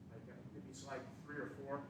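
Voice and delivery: muffled and distant, away from the mic. Real, not acted. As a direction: say it turned away from the microphone. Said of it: "I'm muffled".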